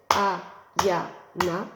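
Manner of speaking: A-ya-na scanned beat by beat; syllables tapped out. Shown as a demonstration. Teacher explains scanning, chanting the name. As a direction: tap out the three beats. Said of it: "quand on les scande".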